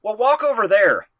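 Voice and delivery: lightly voiced dad pointing somewhere vaguely; typical dad. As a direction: toss off a vague dad instruction. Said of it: "in typical dad fashion".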